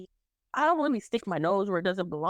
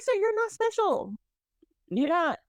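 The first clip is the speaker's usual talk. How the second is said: raised pitch, each word drawn out to cut him down; slow and high, talking down to him; high, slow sassy put-down; 11 semitones higher than usual. Retold or imitated. Cashier telling off a pushy customer, high and slow. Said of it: "I was like".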